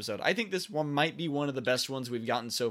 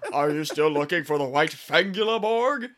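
loud, higher-pitched eager character voice; Fangula; 4 semitones higher, 8 dB louder than usual. Acted. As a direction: burst in calling out the question, loud and high. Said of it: "Fangula comes running in as".

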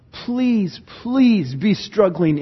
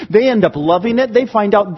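ordinary talk

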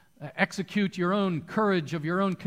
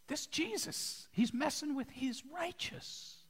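hushed warning; 8 semitones higher, 10 dB quieter than usual. Retold or imitated. Holy Spirit's hushed warning.

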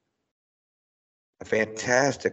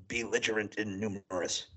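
dragon voice; 10 dB quieter than usual. Retold or imitated. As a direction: rumble it like an alien monster. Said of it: "And Morbo then responds".

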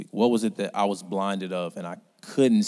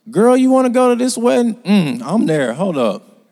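loud, high gossipy friend; a hint of a chatty girlfriend; mock friend; 11 semitones higher, 10 dB louder than usual. Mimicking a friend inviting you to a wedding.

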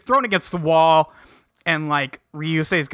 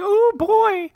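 much higher, squeaky mock "oh boy"; mock dismay. [name] as Ryusei walks in late, high mock dismay. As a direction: pitch it way up like a cartoon sigh.

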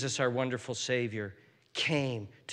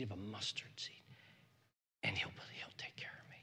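intimate whisper; 2 semitones lower, 4 dB quieter than usual. Acted. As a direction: lean in and whisper comfort. Intimate whispered prayer to congregation.

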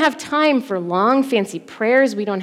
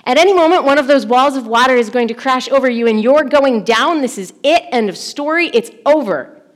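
louder, pressing storm narration; urgent alarm; 3 semitones higher, 6 dB louder than usual. Acted. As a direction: build the danger with raised, urgent voice. Imagined boat about to be swamped.

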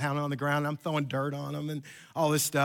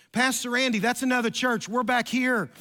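put-on girl calling out; 6 semitones higher than usual. Girl calling from behind the pastor.

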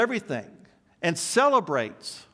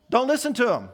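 "don't listen to him" said higher and louder, punched; emphatic command; 7 semitones higher, 3 dB louder, faster than usual. Retold or imitated. Paul ordering believers to ignore angels, "don't listen to him" hit hard. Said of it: "he says it so emphatically".

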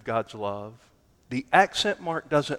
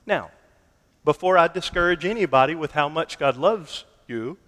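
ordinary delivery

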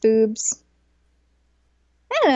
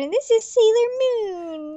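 Chibiusa voice; 10 semitones higher, slower than usual. Pretend. Voicing Chibiusa introduction.

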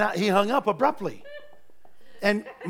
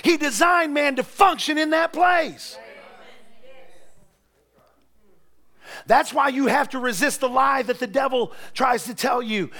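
loud, higher, rising sermon delivery; preaching; 3 semitones higher, 4 dB louder than usual. Real, not acted. Preacher urging the congregation to live in God's presence, preaching hard. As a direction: preach it with full force.

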